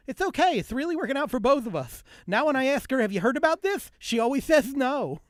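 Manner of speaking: high, loud, lisping impression; Jay Leno. Comedian quoting Leno on his wife, high lisping impression. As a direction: pitch it way up with a bit of a lisp. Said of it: "my Leno impersonation".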